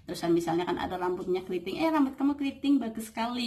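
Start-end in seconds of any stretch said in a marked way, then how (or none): 1.8-3.5 high-pitched admiring praise; acted compliment. Showing how to compliment curly hair, acted admiration. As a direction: play delighted flattery, lifting the pitch. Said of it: "memuji itu jauh lebih bagus".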